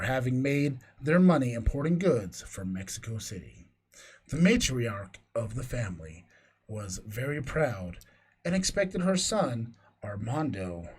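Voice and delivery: low, hushed ghost story read; spooky storytelling. Acted. Reads ghost story aloud.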